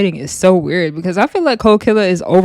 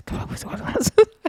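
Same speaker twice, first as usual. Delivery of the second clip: hushed, wordless whisper imitation; imitated whispering; 13 semitones higher, 5 dB quieter than usual. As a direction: mimic hurried secret coaching under the breath. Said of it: "Ivory is still whispering to Cold Killer".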